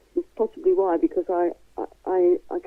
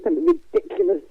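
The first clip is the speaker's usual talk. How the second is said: mock disbelief; 11 semitones higher, faster than usual. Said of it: "I'd have gone".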